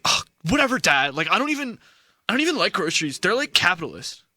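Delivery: put-on high, bored teen eye-roll; dismissive, whiny teenager; sulky teenager. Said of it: "that's what a seventeen-year-old would say".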